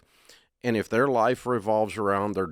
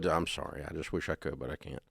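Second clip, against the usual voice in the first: low, quiet, gentle refusal; soft firm no; 6 semitones lower, 10 dB quieter than usual. Shown as a demonstration. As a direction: say no softly but firmly. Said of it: "You just say".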